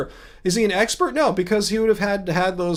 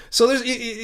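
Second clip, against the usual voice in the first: ordinary talk, nothing marked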